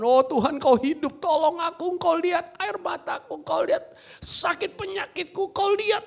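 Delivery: high, rising desperate plea; pleading prayer. Retold or imitated. Troubled believer crying out to God, high plea. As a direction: beg God for help. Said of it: "waktu punya persoalan".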